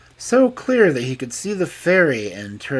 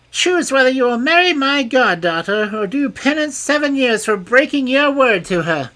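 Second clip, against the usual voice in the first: much higher, louder furious ultimatum; furious fairy; 9 dB louder than usual. A narrator's reading. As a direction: threaten the king. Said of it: "said furiously".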